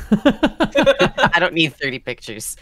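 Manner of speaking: loud, slow, laughing parody; hammed-up mocking echo; laughing mock repeat. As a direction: echo her words while cracking up. Mocking friend's quote.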